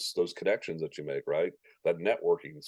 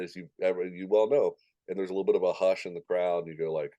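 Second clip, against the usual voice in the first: ordinary delivery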